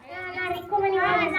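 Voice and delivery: ordinary talk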